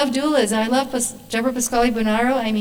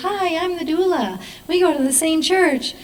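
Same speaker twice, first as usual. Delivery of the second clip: chirpy doula greeting; 7 semitones higher than usual. Retold or imitated. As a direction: imitate an over-friendly doula introducing herself. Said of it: "the woman that opens the door and goes".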